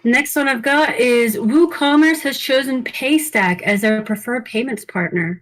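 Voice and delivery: usual speaking voice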